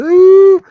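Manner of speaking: mock heartbroken fans groaning; mock fan wail. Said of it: "are gonna be going".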